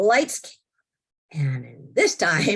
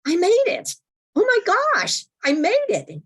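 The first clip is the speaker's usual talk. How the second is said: much higher, louder burst of joy; elated; 4 dB louder than usual. Retold or imitated. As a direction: squeal it like a win. Joy on realising it's heaven.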